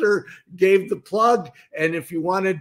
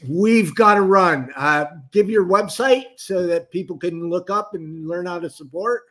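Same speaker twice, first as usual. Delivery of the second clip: usual speaking voice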